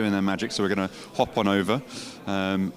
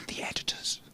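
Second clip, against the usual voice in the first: hushed wildlife narrator whisper; nature documentary; 10 semitones higher, 9 dB quieter than usual. Pretend. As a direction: narrate like observing rare animals. Sneaking in on working editors.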